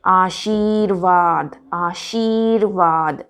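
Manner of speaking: आशीर्वाद very slow, long "shee"; careful model pronunciation. Shown as a demonstration. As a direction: stretch शी and वा in आशीर्वाद. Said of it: "इसमें सबसे ज़्यादा गलती होती है". (आशीर्वाद धीरे-धीरे, लंबी ई)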